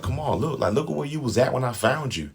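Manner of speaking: lightly voiced manipulative boyfriend; mock Joe voice. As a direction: imitate him guilt-tripping her. Mocking Joe's gaslighting line.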